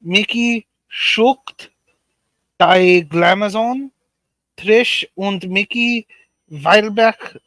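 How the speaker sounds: reading names in a fake German accent; mock German accent, drawn out; pseudo German accent. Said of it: "your pseudo German accent wasn't too bad".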